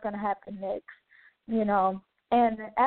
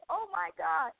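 high, stunned exclamation; retold amazement; 8 semitones higher, 4 dB quieter than usual. Watching the lady pay cash for her bill, retold amazement. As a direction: relive the disbelief, pitched well up.

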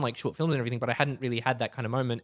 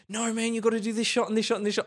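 insistent writer; 10 semitones higher than usual. Retold or imitated.

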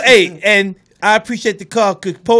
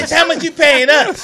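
cheeky; 8 semitones higher, 6 dB louder than usual. Retold or imitated. Retells line in mock voice.

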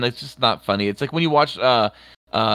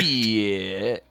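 low, long-stretched "Shit"; shit guy catchphrase; 5 semitones lower, 4 dB quieter than usual. Retold or imitated. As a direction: drag "Shit" out in imitation. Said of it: "I know the shit guy".

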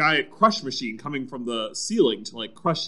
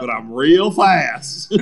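slow mock boast; 3 semitones lower, 7 dB louder, much slower than usual. Finishing Al's boast.

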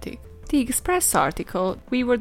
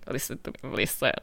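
hushed; 7 semitones lower, 6 dB quieter than usual. Acted. Conspiratorial aside to listeners.

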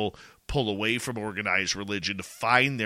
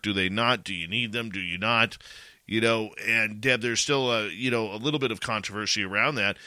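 normal talking voice

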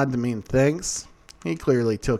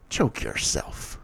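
slow, low, whispered order; sinister command; 8 semitones lower, 4 dB quieter, slower than usual. A narrator's reading. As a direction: breathe the order out slowly, menacing. Damien commands bully with power.

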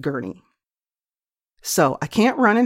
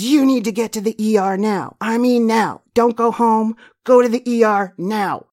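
louder, higher urgent order from a panicking dentist; panicked dentist; 5 semitones higher, 4 dB louder than usual. Retold or imitated. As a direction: bark the order to go to the ER now. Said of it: "So she goes".

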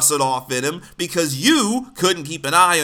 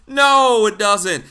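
emphatic no; 4 semitones higher, 6 dB louder, much slower than usual. Real, not acted. Rejects the idea of an apologetic parent.

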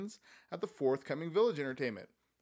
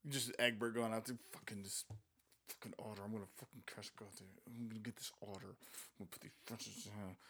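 low, much softer mocking mutter; muttered aside; 5 semitones lower, 14 dB quieter, faster than usual. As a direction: grumble it under your breath as Egbert. Mocking Egbert under breath.

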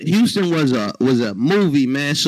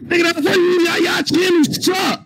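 shouting; 6 semitones higher than usual. Real, not acted. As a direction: yell it at them. Roasting rival podcast, shouting.

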